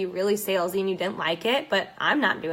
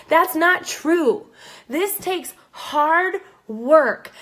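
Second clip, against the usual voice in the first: higher, very slow, each word leaned on; slow emphatic; 5 semitones higher, 4 dB louder, much slower than usual. Real, not acted. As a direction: stretch it out to drive it home. Emphatic point in her rant.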